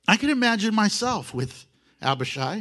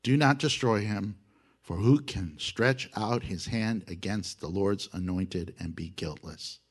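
calm him down in a grave hush; David quietly forbidding Abishai, solemn and low; solemn hushed warning; 10 semitones lower, 5 dB quieter than usual. Retold or imitated. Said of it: "David said to Abishai".

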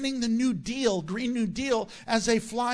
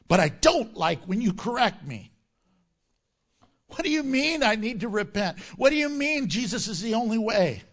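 raised, louder indignant sinner act; mock angry protest; 3 semitones higher, 4 dB louder than usual. Rebuked people push back at the pastor, mock angry. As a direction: protest in hammed-up outrage. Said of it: "But they get angry".

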